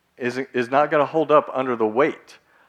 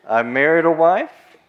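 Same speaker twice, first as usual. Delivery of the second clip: louder and drawn out, wife rising; emphatic retelling; 5 semitones higher, 9 dB louder, slower than usual. Retold or imitated. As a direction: lean hard on the excuse, slowly.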